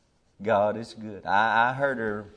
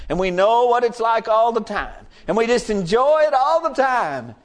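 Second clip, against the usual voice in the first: rising higher and louder preaching; building sermon; 6 semitones higher, 7 dB louder than usual. Acted. Preacher building to the congregation, higher and louder. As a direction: climb in pitch and force.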